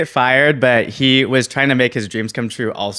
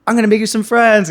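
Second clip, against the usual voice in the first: high, louder friendly waitress; bright caring offer, pitched up; 12 semitones higher, 8 dB louder than usual. Retold or imitated. Filipino waitress offering help, high friendly voice. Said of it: "she was like".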